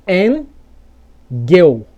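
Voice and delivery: angle said "en-gel", split in two; inverted L. Shown as a demonstration. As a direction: swap L and E, pausing inside engel. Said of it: "ficaria assim".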